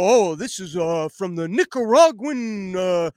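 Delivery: lightly done cartoon bear voice; a hint of a slow, folksy bear; Yogi Bear voice. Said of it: "like, a Yogi Bear voice".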